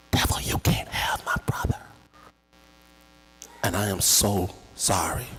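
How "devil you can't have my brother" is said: fierce whisper at the devil; whispered defiance. Retold or imitated. Brother shielding his sibling from the devil, whispered. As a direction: whisper it like a prayer against evil. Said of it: "grabs me around the neck and says".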